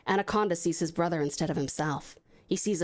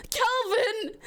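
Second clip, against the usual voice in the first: high anguished cry of his brother's name; desperate shout. Acted. Anaconda crying over his brother's corpse, a high desperate shout. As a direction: call out to a dead brother. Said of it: "he shouts with desperation".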